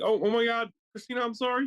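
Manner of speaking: high-pitched mock-alarmed apology; panicked apology. Husband apologizing to crying wife, mock panic.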